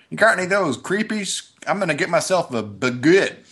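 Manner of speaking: twangy country bumpkin voice. Retold or imitated. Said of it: "all sorts of hicks will come in and order".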